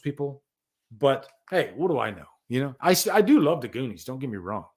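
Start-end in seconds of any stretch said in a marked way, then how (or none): none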